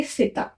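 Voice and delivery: "सिता" as two syllables, si-ta; pronunciation demo. Shown as a demonstration. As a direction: say "सिता" with an open final a. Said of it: "उसको हम pronounce करेंगे". ("सिता" को सि-ता बोलें)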